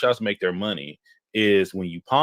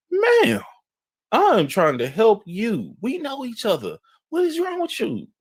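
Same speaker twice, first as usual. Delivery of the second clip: much higher, slow, pleading ma'am; pleading young clerk; 14 semitones higher, slower than usual. Retold or imitated. Clerk pleads with the cussing customer, much higher and pleading. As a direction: beg her to calm down in a high voice.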